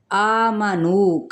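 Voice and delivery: आमनू with each long vowel held one alif, slow; slow tajweed recitation; stretched vowels. Shown as a demonstration. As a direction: hold the आ and नू of आमनू. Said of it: "एक अलिफ के बराबर खींचेंगे".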